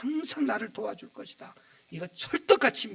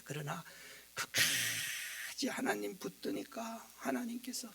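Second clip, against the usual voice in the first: slow hushed whisper; 6 semitones lower, 7 dB quieter, slower than usual. Real, not acted. Pastor whispering to the congregation about holding on. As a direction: lean in and whisper it.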